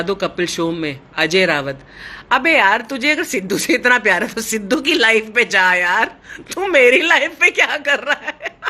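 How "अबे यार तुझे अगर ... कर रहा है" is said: louder, higher, fast mock telling-off; mock scolding. Real, not acted. To a commenter who wants Sidhu back, a louder mock scolding. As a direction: tell the troll off, half laughing.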